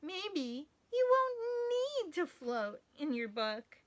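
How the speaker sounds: lightly voiced soothing Gertie. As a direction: reassure Bernard gently. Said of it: "said Gertie".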